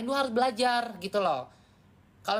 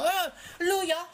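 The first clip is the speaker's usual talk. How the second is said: tantrum demo; 11 semitones higher than usual. Shown as a demonstration. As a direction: throw a hammed-up fit at someone. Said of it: "itu tantrum".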